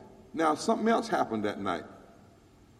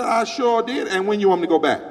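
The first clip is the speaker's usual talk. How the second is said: louder, higher eager reply; loud retold eager reply; proud retort; 6 semitones higher, 9 dB louder than usual. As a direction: answer him with fire. Lady's retold speech.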